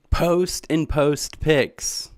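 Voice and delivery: very slow, deliberate; slow spelled out. Real, not acted. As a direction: lay it out word by word. Spelling out channel name.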